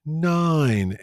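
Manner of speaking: high, loud drawn-out count; shouted referee count. Retold or imitated. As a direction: call the nine like a referee. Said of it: "we go, like".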